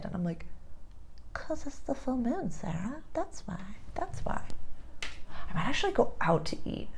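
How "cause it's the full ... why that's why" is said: soft whispered reply to a coworker; whispering to Sarah that it's the full moon; knowing whisper. Retold or imitated. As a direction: hush it like a secret.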